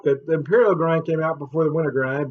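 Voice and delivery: usual speaking voice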